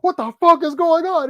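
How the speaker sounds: high, loud furious yell; hammed-up raging director; mock fury. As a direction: blast it backstage. Director storms backstage.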